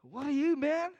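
mock the bloke squaring up; drinker's challenge.

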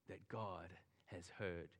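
slow, hushed whisper of comfort; intimate whisper. Acted. Pastor assures weary believers their prayers were heard, whispered slowly. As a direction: breathe it gently, like a secret promise.

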